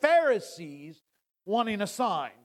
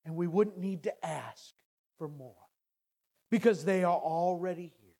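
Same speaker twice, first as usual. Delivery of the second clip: lower, much softer, pausing sermon voice; hushed reflection; 5 semitones lower, 6 dB quieter than usual. Acted. Preacher musing on God's signs to the congregation, hushed. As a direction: draw them in with a near-hush.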